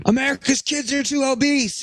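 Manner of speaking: high-pitched put-on rant; mocking shouted complaint. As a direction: pitch it way up, mock-outraged. Acted scream at partner.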